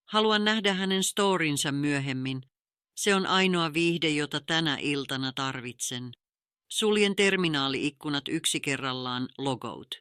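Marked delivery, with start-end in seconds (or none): none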